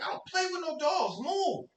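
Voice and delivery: put-on high, whiny child brushing her off; lightly voiced bratty kid. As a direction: snap back like an annoyed little boy. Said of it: "I'm like".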